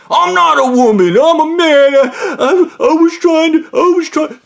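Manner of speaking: high, loud stammering whine; hammed-up whiny protest. Coworker insists to the narrator he's a man, mock whine. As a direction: protest like a flustered crybaby.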